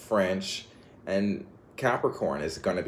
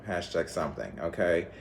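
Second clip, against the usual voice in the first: normal talking voice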